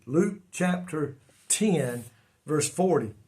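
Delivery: Luke chapter ten said slowly, each word spaced out; slow it down so the congregation can find the page; slow repeated reference. Shown as a demonstration.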